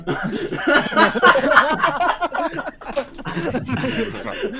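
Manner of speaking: long burst of laughter; uncontrolled laughing fit; real belly laugh. Real, not acted. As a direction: crack up helplessly at a friend. Cracking up at friend's tutorial.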